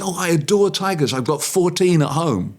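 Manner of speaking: put-on high, gushing little girl. Storyteller voicing a schoolgirl who adores tigers, high and gushing. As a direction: gush like a tiger-mad child.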